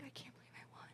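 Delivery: tiny unvoiced whisper; overcome winner. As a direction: whisper it in stunned disbelief, imitating her. Voices bawling winner, whispered.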